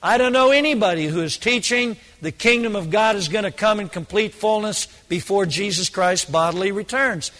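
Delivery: ordinary talk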